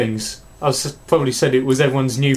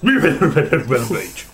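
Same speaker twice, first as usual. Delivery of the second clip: Boris Johnson horse voice. Retold or imitated. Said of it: "Boris Johnson crossed with a horse".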